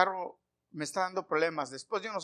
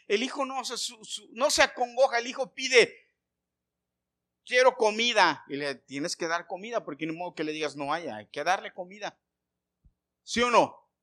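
high, loud, urgent sermon delivery; fervent preacher voice rising and pressing; preaching at full force; 4 semitones higher, 6 dB louder than usual. Acted. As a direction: drive each question home to the congregation. Preacher exhorts congregation.

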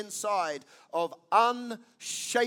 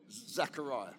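ordinary talk, nothing marked

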